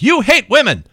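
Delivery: high-pitched, punchy movie-quote accusation; mock accusation. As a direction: mimic an outraged woman accusing a man. Acts out movie heroine's accusation.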